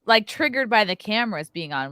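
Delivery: usual speaking voice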